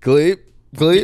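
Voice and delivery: high, loud teasing call; mock call-out. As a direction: yell it to tease a friend. Mocking call-out, repeated.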